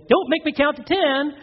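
high, slow mock warning; hammed-up parental ultimatum; threatening parent. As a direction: warn a child with exaggerated exasperation. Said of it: "And then you".

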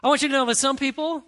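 normal talking voice

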